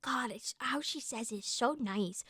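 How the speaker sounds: breathy hushed whisper of delight; whispered swoon. Real, not acted. Streamer whispering, charmed by the narrator's Scottish accent. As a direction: sigh it out under your breath, charmed.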